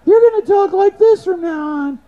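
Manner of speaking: very high, loud squeaky mock voice; neutered squeaky voice. Mocking the neutered bank boss, very high loud squeaky voice. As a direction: squeak it like a newly neutered man. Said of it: "to shorten you up".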